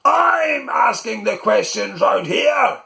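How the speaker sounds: high, loud, slow furious shout; angry shout. Acted. Uncle shouting at Malcolm to stop, furious high yell. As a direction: bellow him down in outrage. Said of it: "Uncle Gob shouted at him".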